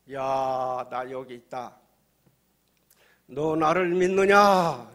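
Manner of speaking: slow commanding call swelling to a loud question; God's voice. Acted. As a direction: call down with drawn-out authority. God answers the man, acted.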